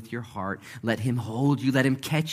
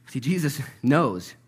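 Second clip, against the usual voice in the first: normal talking voice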